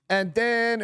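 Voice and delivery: high mock Vietnamese accent. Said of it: "she did the Vietnamese accent".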